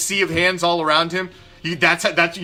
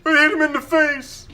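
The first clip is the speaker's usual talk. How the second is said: high, louder mock-whine; whiny excuse voice; 11 semitones higher, 3 dB louder than usual. Vlogger mocking the quarterback's lame excuse, high whiny voice. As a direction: whine the excuse like a sulking kid. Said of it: "mimicking quarterback's excuse".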